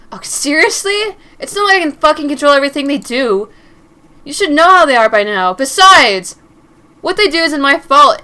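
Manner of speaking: loud furious shout at her; angry yell. Acted. Angry boyfriend yelling at Mary for coming home late. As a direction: yell it at her the moment she walks in.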